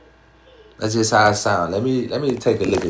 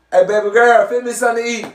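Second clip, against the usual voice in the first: much higher, louder playful holler; playful calling out; 13 semitones higher, 4 dB louder than usual. Pretend. Streamer showing how he asks baby girl for food, playful holler. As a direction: holler it high like a mock call across the house.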